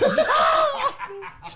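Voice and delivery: high loud laughing shout; real laughter breaking into a yell. Real, not acted. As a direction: burst out laughing mid-rant. Retelling his rant, laughing.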